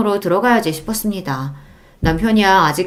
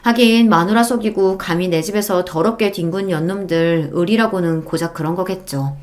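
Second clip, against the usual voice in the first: ordinary delivery, nothing marked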